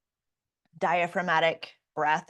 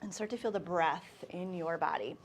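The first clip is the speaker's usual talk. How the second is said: guided meditation; 7 dB quieter than usual. Acted. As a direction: ease the class into stillness.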